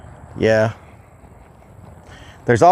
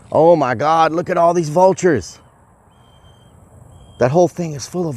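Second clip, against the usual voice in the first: high-pitched amazed outburst; 7 semitones higher than usual. Real, not acted. Showing viewers all these vultures, amazed. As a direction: exclaim in real surprise, voice raised in pitch.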